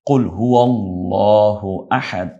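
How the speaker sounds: slow recitation of হুয়াল্লাহু with a full, heavy lam. Shown as a demonstration. Teacher contrasts the heavy lam after a pesh. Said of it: "লামকে পোর করে, মোটা করে".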